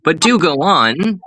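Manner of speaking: high, falsely delighted imitation; mock happy chatter. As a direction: imitate someone cheerfully chatting on, oblivious. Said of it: "you were happily having a one-sided conversation".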